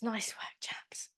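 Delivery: whisper. Real, not acted. Instructor praises the class as they shake out, whisper. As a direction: hush it like a secret well done.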